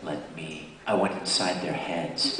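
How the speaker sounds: deep, low voice into the mic; mock-sinister. Actor toying with microphone volume, mock-sinister. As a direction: play at creeping into their heads. Joking about getting inside heads.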